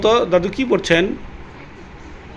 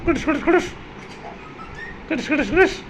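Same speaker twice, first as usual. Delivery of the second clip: squeaky click sound; 10 semitones higher, 6 dB quieter, faster than usual. Pretend. Mimicking camera click for selfie.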